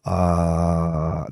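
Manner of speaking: long, low monotone "ah"; sustained "ah" voice exercise. Shown as a demonstration. Patient showing his eight-second attempt, sustained "ah". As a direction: hold the vowel steady until breath runs low. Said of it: "it was eight seconds of".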